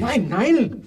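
hohes, lautes, panisches Nein; verzweifelter Schrei. Acted. Verzweifelte Abwehr. (panischer, hoher Aufschrei)

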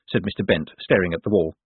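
ordinary delivery

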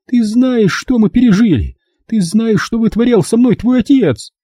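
very high, agitated mother voice; indignant mother. Acted. Mother reminding daughter of father's abuse, indignant. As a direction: challenge her at a high pitch.

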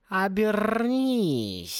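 very slow, high-pitched cat purr. A narrator's reading. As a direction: purr the command with a long rolled r. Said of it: "промурлыкал голос".

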